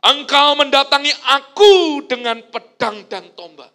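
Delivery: slow, loud, high declaration; David defying Goliath; bold defiance. Retold or imitated. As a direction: boom it at the giant. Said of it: "Daud berkata gini".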